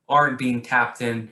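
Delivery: usual speaking voice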